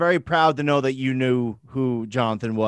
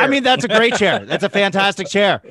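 higher, louder, playful defence; mock-earnest praise; 4 semitones higher, 6 dB louder than usual. Host jokingly defends the broken chair, mock-earnest. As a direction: leap in to champion it emphatically.

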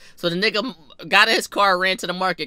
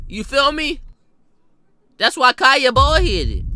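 higher-pitched indignant rant; heated, high-pitched complaining; indignant roasting; 5 semitones higher than usual. Acted. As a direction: roast them in raised, exasperated protest. Mock-angry roast of the relatives.

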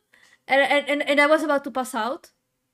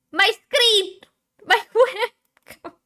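high, loud panicked cry; startled; 8 semitones higher, 7 dB louder, slower than usual. Real, not acted. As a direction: blurt it out in a fright. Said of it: "I got scared".

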